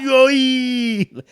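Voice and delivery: high, loud, drawn-out comic yell; startled whoop. Retold or imitated. Storyteller reacting to the guide's blunt answer, comic high yelp.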